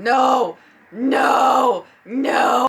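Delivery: the wallet begging no; voicing my wallet. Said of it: "I felt my wallet going".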